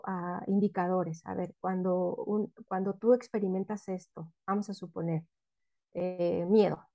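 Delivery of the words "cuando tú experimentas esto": normal talking voice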